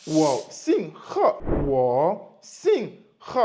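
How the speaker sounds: "Wo Xing He" drawn out very slowly, with "Xing" stretched; slow Mandarin answer. Shown as a demonstration. A teacher showing students how to answer, slowly. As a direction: model "Wo Xing He" syllable by syllable. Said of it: "je dis tout simplement".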